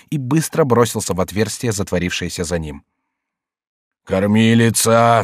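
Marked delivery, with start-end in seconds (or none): 4.1-5.2 slow, drawn-out call; crying out. A narrator's reading. King calling his nurse, drawn-out cry. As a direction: stretch the word into a call. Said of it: "вскрикнул король громче".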